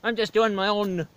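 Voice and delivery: put-on chirpy stage-Irish leprechaun accent; hammed-up Irish leprechaun host; O'Lucky's Irish brogue. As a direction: play a cheeky Irish leprechaun with a thick brogue.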